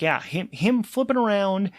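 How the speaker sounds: ordinary delivery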